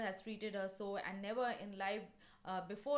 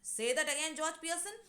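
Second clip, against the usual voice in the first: raised, louder challenge, lightly acted; threatening challenge; 6 semitones higher, 6 dB louder than usual. Mrs Pearson challenging George, threatening. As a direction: dare him to repeat it.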